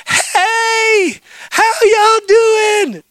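high, loud, drawn-out gushing hello; hammed-up squealing welcome, stretched long; over-the-top greeting. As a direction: shriek a greeting like long-lost family. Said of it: "a greeting ramped way too high".

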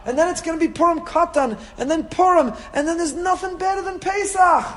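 excited. Real, not acted. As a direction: build up the joy of the holidays. Rousing up for the holidays.

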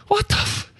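high-pitched, cut-off yelp of shock; stunned disbelief. Retold or imitated. Player flattened by the blocking wall, in stunned disbelief. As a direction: squeak out a startled half-curse.

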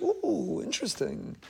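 soft, idle musing; mock-intrigued, hushed aside; mildly noting an observation. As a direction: remark on it quietly, as a passing curiosity. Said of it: "noting an observation".

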